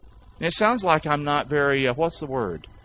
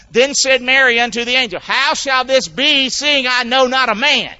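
louder, high preaching read; scripture reading; 7 semitones higher, 5 dB louder than usual. Acted. Preacher reads Luke to the church, proclaiming.

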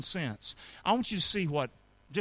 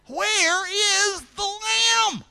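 drawn-out high shout; 6 dB louder, much slower than usual. Pretend. Preacher re-crying Isaac's question.

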